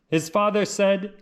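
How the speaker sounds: usual speaking voice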